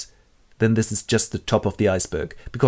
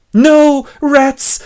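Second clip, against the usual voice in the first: much higher, loud shriek; terrified scream; 9 dB louder than usual. Acted. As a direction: shriek in terror. Faced with rats.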